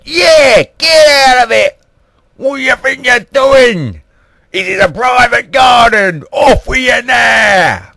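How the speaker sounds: very high, loud screeched telling-off; hammed-up falsetto yelling; uncouth shouting Queen. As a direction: shriek them off the property. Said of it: "heard the Queen speak like this".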